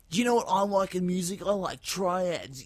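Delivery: high, loud put-on voice; imitated excitable oddball. Bus stranger raving about the music he likes, excitable. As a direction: rant and chant the noises high and loud.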